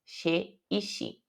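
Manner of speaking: "Che e chi" with a soft sh sound, Portuguese style. Shown as a demonstration. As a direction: say "Che e chi" as she and shi. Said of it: "como se tivesse som de X".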